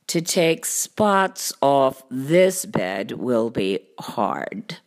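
low, slow cartoon cat voice; deep, measured storybook cat; pondering cat. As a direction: weigh it slowly, deep and deliberate. Cat ponders the stained bed.

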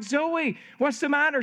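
imitating a parent checking on a child; retold concerned dad. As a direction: ask a crying little one, lightly acted. Father asks his crying toddler.

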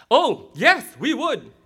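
high, loud mock enthusiasm; eager kids agreeing. Preacher voices kids offering a hundred, eager mock shout. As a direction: chime in like keen schoolchildren. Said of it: "We all agreed".

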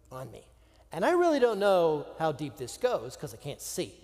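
ordinary delivery

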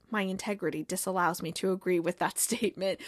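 low, hushed, solemn mock-prophet; grand soft Zava voice. Star player solemnly greeting his new manager, low and hushed. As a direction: intone it like a self-serious guru.

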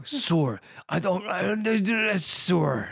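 bad American accent, slow mangled "Sir"; Jason Statham. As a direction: labour over "Sir" in botched American. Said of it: "he can't figure out how to do it".

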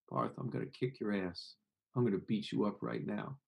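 soft, low threat. Shown as a demonstration. Threatening Parth but speaking low and soft. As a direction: say the threat gently under your breath. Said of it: "he says it like that".

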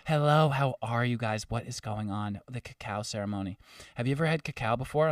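soft, serene imitation of a mystical woman; gently mocking ethereal hostess; majestic woman. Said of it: "majestic woman just, like, came out".

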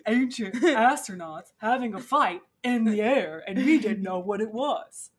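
slow, loud, hammed-up alien theorist; crazy hair guy. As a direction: proclaim the wild theory with heavy emphasis. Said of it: "the crazy hair guy is like".

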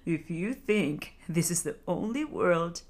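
lightly voiced old turtle, gently amused at "only"; a hint of an old turtle's indulgent teasing. A narrator's reading. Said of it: "The turtle chuckled".

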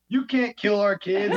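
high, slow mock outrage, laughing through it; mock outraged moms. Podcaster mocks protesting moms, high and laughing. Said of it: "and they're like".

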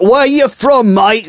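high-pitched mock Cockney question. Rock hero quizzing a fan over Skype, mock Cockney. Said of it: "And he's like".

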